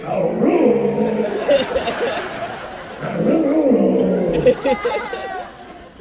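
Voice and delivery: hoarse wheezy dog bark imitation; raspy sick-dog bark. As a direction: mimic a dog barking through a throat hole. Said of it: "Now he barks like this".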